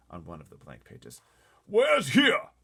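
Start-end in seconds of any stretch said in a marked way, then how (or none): 1.6-2.5 high, loud frightened cry; a blustering general in a panic; terrified army chief. Acted. Army head crying out from the back seat, terrified. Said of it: "cried the head of the army".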